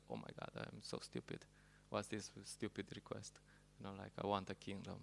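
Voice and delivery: hushed, embarrassed self-reproach; ashamed whisper. Retold or imitated. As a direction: whisper it as if caught being foolish. Dhruva ashamed of his request.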